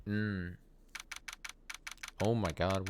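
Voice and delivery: normal talking voice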